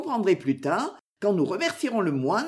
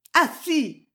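higher, insistent correction; insistent repetition; 7 semitones higher than usual. A narrator's reading. Maud corrects the monk's story, insistent. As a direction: repeat it higher to contradict. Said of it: "répéta Maud".

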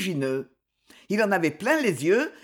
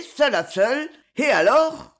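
raised, louder vengeful threat; menace; 5 semitones higher, 4 dB louder than usual. A narrator's reading. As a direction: build to an open-ended threat. Cogolin threatens revenge to himself. (menace lancée, voix montante)

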